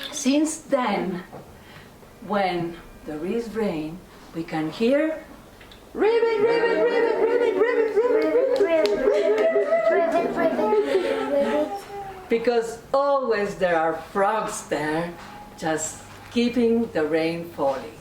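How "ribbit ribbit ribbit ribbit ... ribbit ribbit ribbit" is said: high ribbit croaking fading down; frog croak. A narrator's reading. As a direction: croak ribbit, much higher, then settle. Frogs heard in the rain.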